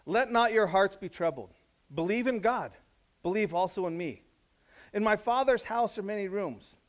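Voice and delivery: loud, high, proclaiming scripture; scripture reading. Acted. Pastor reading John to the church, loud and proclaiming. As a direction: read the words out like a herald.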